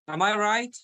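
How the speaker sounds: "Am I right" high, lifting on "right"; rising question. Shown as a demonstration. Teacher giving example questions, voice going up on "right". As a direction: send "right" upward, checking. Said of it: "So your voice is going up".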